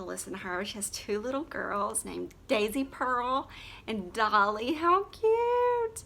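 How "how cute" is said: gushing. Real, not acted. Gushing over singer's daughters' names.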